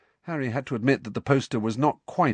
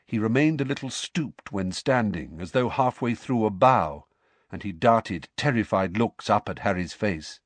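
ordinary delivery

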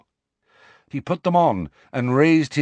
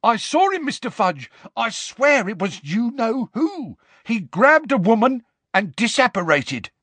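high, eager insistent voice; excited wizard; 11 semitones higher than usual. Acted. Ponytailed wizard insists to Fudge, high and eager. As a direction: swear to the minister breathlessly.

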